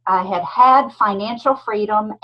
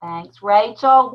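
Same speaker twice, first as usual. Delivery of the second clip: normal talking voice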